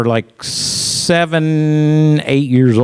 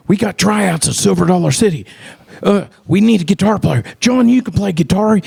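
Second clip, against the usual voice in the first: excited urging; 8 semitones higher than usual. Retold or imitated. Urging John to try out.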